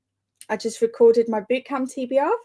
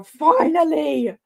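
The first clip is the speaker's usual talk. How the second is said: slow, stretched Finally, sung out higher; drawn-out relief; 6 semitones higher, 4 dB louder, slower than usual. Real, not acted. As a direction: draw out Finally with triumph.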